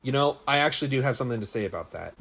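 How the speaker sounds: ordinary talk, nothing marked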